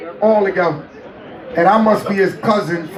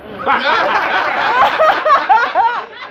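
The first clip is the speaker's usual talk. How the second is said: high, bursting real laugh; a long, high peal of real laughter; big real laugh; 11 semitones higher than usual. Real, not acted. Laughing at Prince's gesture.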